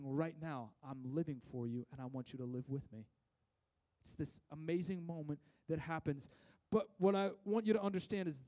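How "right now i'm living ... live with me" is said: tender voice of Jesus. Retold or imitated. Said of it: "And he's saying".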